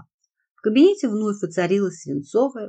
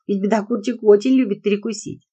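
put-on higher, louder girl's playful voice; little girl voice; 4 semitones higher, 6 dB louder than usual. A narrator's reading. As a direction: tease like a cheeky little girl. Liza teasing about Bedokur.

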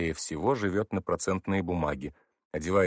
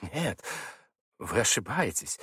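unsure denial; 3 semitones higher, slower than usual. A narrator's reading. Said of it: "не слишком уверенно стал оправдываться".

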